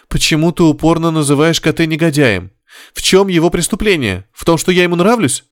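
high-pitched, agitated questioning; indignant protest. A narrator's reading. Wife defends admirer to her husband, indignant. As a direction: challenge him, voice climbing high.

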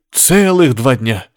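drawn-out, mildly indignant protest; ardent indignation. A narrator's reading. Lovesick patient protests to the nurse, ardently indignant. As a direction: stretch it out in a lover's complaint. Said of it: "пылко возмутился Горишвили".

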